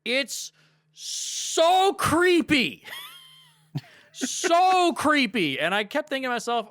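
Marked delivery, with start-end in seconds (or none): 0.9-2.8 SO stretched long, high and loud; emphatic creeped-out drawl. Real, not acted. Fan recoiling at a film scene, emphatic creeped-out drawl. 4.1-5.6 "So" held long, high and loud; emphatic creeped-out drawl. Real, not acted. Fan repeating disgust at Superman Returns, emphatic creeped-out drawl.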